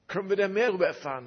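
high, loud, fast eager asking; eager question. Retold or imitated. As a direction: rush it out like a thrilled pastor.

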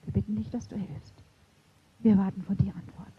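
soft whispered plea; praying humbly to Jesus in a whisper; whispered prayer. Retold or imitated. Quiet prayer retold.

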